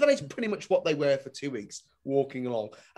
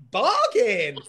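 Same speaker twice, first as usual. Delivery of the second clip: higher, punched bargain hunter's cry; delighted exclamation; 5 semitones higher, 3 dB louder than usual. Acted. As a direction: pounce on it with glee. Acted delighted reaction to cheap shorts.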